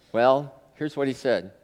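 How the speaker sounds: usual speaking voice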